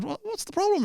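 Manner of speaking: high, sing-song mock concern; comic needling a heckler in a high voice; mock polite comic. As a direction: press him with fake politeness. Comic pressing again.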